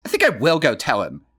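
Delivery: smirking. Acted. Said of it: "his sister said, smirking".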